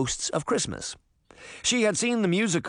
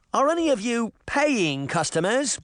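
put-on Tim, English boy; 8 semitones higher than usual. Tim's voice, English accent.

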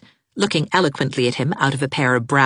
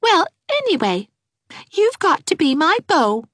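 calm insistence; 3 dB louder than usual. Acted. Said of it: "returning calmly to the vital subject".

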